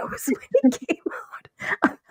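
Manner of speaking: whispered, fast confession; whispering. Real, not acted. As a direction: lean in and whisper it. Secretive build-up of story.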